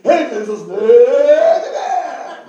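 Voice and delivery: very loud, high, slow shouted "amen"; loud shouted prayer. Shown as a demonstration. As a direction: show the wrong way: just yell the prayer. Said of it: "It's not about loud".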